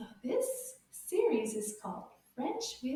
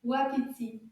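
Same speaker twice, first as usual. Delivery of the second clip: "Wapiti" run together as one quicker word; a bit faster; 3 dB louder than usual. Shown as a demonstration. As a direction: say "Wapiti" more briskly, syllables joined. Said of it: "a little bit faster".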